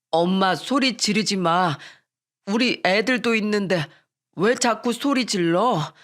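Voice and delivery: slow, troubled plea from a son; lightly acted, slowed-down embarrassed son; uneasy son. A narrator's reading. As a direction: plead awkwardly with your mother. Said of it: "난감한 듯 말했어요".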